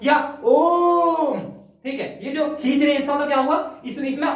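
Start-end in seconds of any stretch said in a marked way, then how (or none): 0.5-1.4 ओम stretched out very slowly, louder and higher; protracted chant vowel; very long ओम. Shown as a demonstration. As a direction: pull the ओम out three times as long. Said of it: "तो वो खींच दिया".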